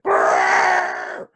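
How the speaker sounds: loud buzzing-lipped blare; elephant trumpet. A narrator's reading. Storyteller imitating gigantic elephants, loud trumpeting. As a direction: trumpet through buzzing lips. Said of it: "And the elephants went".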